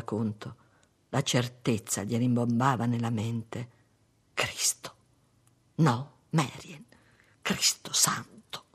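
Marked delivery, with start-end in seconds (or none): 4.3-8.6 whispered, slow cry of shock; stunned, breath-only oath; hushed exclamation. A narrator's reading. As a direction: exclaim under your breath. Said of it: "esclamò".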